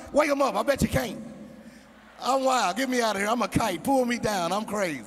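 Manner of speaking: hammed-up flashy preacher voice; ex-pimp faith healer. Ex-pimp TV faith healer working the crowd, hammed-up. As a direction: heal them with slow showman flair, then wild shouts. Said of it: "He be talking like this here".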